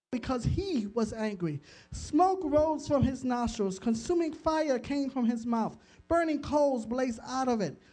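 dramatic reading of David's song; scripture reading. Acted. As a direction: read the psalm with weight and awe.

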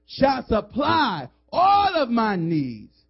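slow, loud, drawn-out preaching of scripture; preaching the verse. Real, not acted. Pastor thundering scripture to the congregation, slow and loud. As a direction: proclaim each word.

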